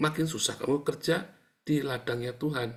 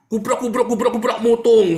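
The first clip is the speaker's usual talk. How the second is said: much louder and higher, banging out the crashes; loud crash sounds; 11 semitones higher, 11 dB louder than usual. Retold or imitated. Preacher shouts crash noises describing household fights, loud and high.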